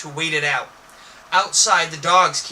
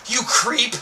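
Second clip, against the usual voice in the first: a light teasing yell, higher; mock yell; 6 semitones higher than usual. A narrator's reading. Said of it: "you yell".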